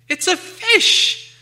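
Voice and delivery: very high, loud, slow creature crow; triumphant Gollum. Acted. Gollum gloating over the answer, very high and slow. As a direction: draw it out in triumph.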